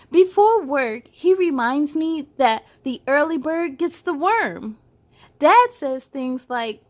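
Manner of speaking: bright read-aloud storytelling; picture book read to little kids; story narration. A narrator's reading. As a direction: read it to children, lively and clear.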